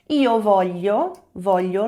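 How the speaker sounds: slow careful "voglio" with the palatal l; pronunciation demo. Shown as a demonstration. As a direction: model the gli sound in "voglio". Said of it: "lembra da pronúncia do G L I?".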